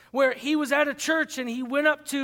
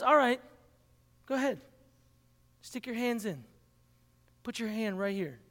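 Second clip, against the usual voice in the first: softer, inviting; gentle Jesus; 5 dB quieter than usual. Acted. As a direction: coax him gently closer. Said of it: "and he says".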